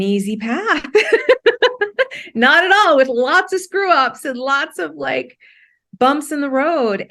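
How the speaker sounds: amused laughter at her own bumpy road; laughing. Real, not acted. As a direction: laugh off the screw ups. Laughs about rough path.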